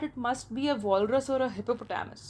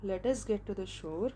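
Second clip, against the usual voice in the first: low, trembling; 3 semitones lower, 7 dB quieter, slower than usual. A narrator's reading. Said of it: "in a low, trembling voice".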